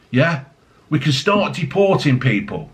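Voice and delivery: normal talking voice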